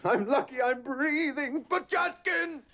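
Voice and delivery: high, strained, slow near-yelp ending in a call; frazzled outburst. Acted. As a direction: flail for help. Exasperated, calling for assistant.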